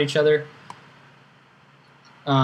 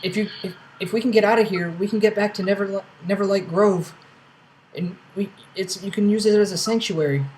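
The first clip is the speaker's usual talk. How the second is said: higher-pitched character voice; NPC voice; 6 semitones higher, faster than usual. Acted. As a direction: speak up higher, eager. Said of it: "He says".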